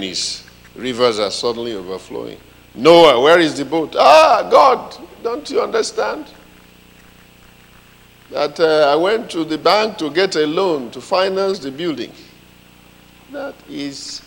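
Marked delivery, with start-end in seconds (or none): 2.7-3.9 loud booming call of God; God calling loudly. Retold or imitated. God demands the boat. 4.0-12.1 high, loud flustered pleading; pleading excuses. Retold or imitated. Noah pleads excuses to God.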